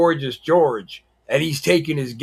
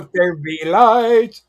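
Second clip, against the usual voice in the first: slow, grand God voice; mock solemn creation line; mock biblical; 3 semitones higher, 4 dB louder, slower than usual. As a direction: intone it like scripture. Biblical joke about lights.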